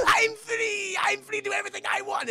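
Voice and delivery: high, shouted demon glee; gleeful demon. Acted. Freed demon inside Itadori screams in high glee. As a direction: howl your freedom like a crazed demon. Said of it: "the Mark Hamill demon goes like".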